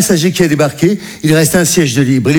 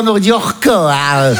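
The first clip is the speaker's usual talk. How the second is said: usual speaking voice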